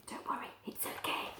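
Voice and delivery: soft reassuring whisper. Pretend. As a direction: whisper comfort to the frightened whale. Comforting the whale in a whisper.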